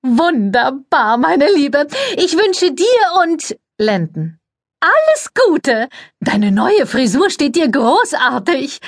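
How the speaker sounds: very high, sugary society voice; gushing press lady. A narrator's reading. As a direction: coo it, all air kisses and fuss. Cordelia voiced in story.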